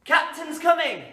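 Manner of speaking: loud high call. Acted. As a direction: announce it like a lookout. Game call to class.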